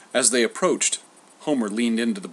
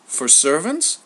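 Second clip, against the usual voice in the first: mildly innocent question, pitched up; 5 semitones higher, 4 dB louder, slower than usual. A narrator's reading. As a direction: ask it like a naive boy. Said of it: "Glaucus asked innocently".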